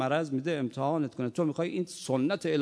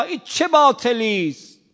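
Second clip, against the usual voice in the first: loud rebuke; 7 semitones higher, 12 dB louder than usual. Acted. Rebuke at full force.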